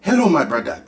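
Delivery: mock African accent, HELLO leaned on and falling; joining the African accent. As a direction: greet him as a mock African brother. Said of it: "I might as well join in too".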